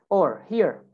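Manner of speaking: ordinary delivery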